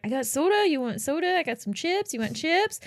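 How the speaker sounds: put-on high, lilting eager-kid offers, rising at the ends; eager offering. Shocked boy offering guests soda and chips, eager offering. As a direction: imitate an over-eager little host.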